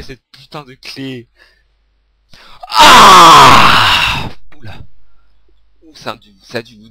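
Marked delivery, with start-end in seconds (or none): 2.7-4.3 loud raw scream of frustration; real scream. Real, not acted. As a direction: let out a full yell at the game. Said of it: "Désolé d'avoir crié aussi fort". (cri de rage)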